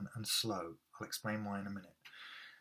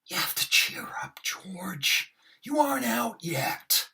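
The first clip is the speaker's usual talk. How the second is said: big theatrical build; overacted Moss; 11 semitones higher, 7 dB louder, slower than usual. Shown as a demonstration. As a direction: whisper rising to a loud, much higher cry. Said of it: "I really overact them".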